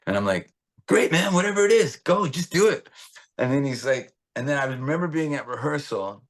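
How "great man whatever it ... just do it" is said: high, loud, hurried-sounding enthusiasm; pitched-up retold excitement; eager go-ahead. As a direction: urge him on excitedly. His eager reply to Sammy.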